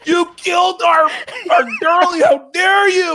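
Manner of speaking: hammed-up wail of grief and fury; mock outraged villagers. Village mourning their girl, hammed-up high crying shout. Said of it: "We're like".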